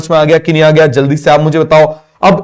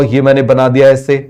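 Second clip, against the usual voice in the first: ordinary talk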